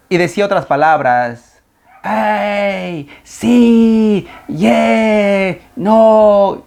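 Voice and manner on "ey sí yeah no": high, louder shouted words; mock shouts. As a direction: yell single words at the hills. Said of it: "Empezaba a gritar". (gritos a todo pulmón imitados)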